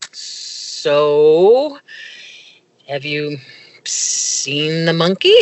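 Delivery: drawn-out, hesitant asking with pauses; wary question. Retold or imitated. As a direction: ease into it carefully, stretching the words. Retold wary question to daughter.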